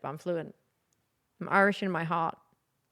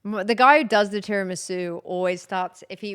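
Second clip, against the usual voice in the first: ordinary talk, nothing marked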